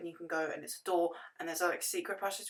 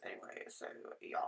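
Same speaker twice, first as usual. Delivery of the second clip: whisper; 12 semitones lower, 15 dB quieter, faster than usual. Real, not acted. Aside whispered.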